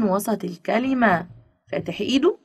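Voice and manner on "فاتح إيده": ordinary talk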